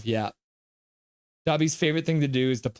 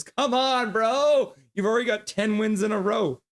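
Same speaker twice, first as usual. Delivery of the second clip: high pleading complaint; exasperated whine; 9 semitones higher than usual. Real, not acted. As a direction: whine it in disbelief. Exasperated at rival team.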